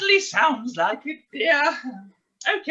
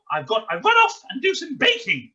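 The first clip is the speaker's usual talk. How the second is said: usual speaking voice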